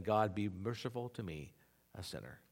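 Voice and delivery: a broken man begging mercy; humble plea. Acted. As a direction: contrite whisper-soft prayer. Voicing the humble publican's prayer.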